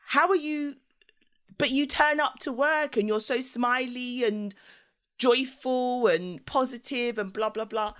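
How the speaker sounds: high bubbly coworker impression; chirpy colleagues. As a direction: play the puzzled cheerful coworkers. Colleagues voiced.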